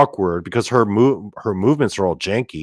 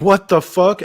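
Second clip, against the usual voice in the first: high, alarmed what the fuck; shocked; 11 semitones higher, 4 dB louder than usual. Retold or imitated. Narrator seeing the fly girl at the podium, high and shocked. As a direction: blurt it out in disbelief.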